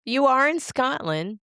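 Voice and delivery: high, loud sudden realization; surprised. Real, not acted. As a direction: pop up with surprise. Reacting to salmon news.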